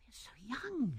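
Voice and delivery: put-on high, breathy young woman whispering; Ethel's whisper. A narrator's reading. To Lewisham, doubting their marriage plan, in a whisper. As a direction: whisper it, unsure and anxious. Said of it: "she said".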